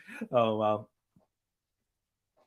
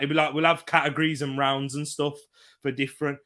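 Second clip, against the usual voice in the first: ordinary talk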